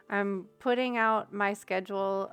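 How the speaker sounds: ordinary delivery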